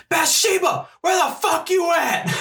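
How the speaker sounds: very high, slow, far-off shouted call; silly high voice. Pretend. Kicking in the haunted house door, high shouted call. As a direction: bellow it out in a squeaky hammed-up voice. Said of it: "That was a really good voice".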